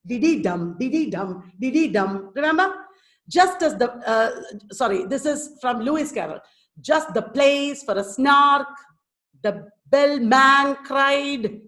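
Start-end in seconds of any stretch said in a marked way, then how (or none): 0.1-2.3 diddy DUM, repeated evenly; two light syllables in "diddy", then weight on "dum"; anapestic beat. Shown as a demonstration. Teacher demonstrating an anapest, unstressed-unstressed-stressed beat. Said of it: "unstressed, unstressed, stressed". 6.8-11.6 "Just the place for a snark" slow, PLACE and SNARK stretched; showing the stresses. Shown as a demonstration. Teacher showing where the stress comes, slow scanned recitation. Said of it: "showing you where the stress comes".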